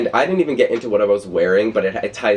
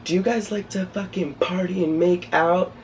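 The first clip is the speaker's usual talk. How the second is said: imitating a pop singer; 11 semitones higher than usual. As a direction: put on a singer hyping a crowd, pitched way up. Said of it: "the one with the fucking, like, longer hair said".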